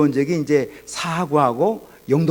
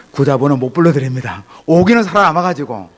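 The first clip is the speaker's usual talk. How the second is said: louder, defiant retort, rising in pitch; stubborn refusal; 6 dB louder than usual. Retold or imitated. Son refusing his father's offer on the phone, defiant. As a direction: re-enact a wounded son refusing point-blank.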